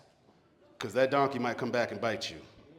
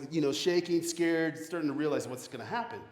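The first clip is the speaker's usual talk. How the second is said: ordinary talk, nothing marked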